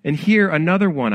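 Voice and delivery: a mild storybook wail; narrated wail. A narrator's reading. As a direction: read it as a dismayed cry, lifted but controlled. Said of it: "I wailed".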